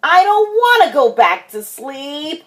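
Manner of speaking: put-on high-pitched child's protest; crying out in protest. A narrator's reading. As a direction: object like a little kid refusing bed. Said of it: "cried Froggy".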